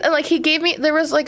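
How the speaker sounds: ordinary talk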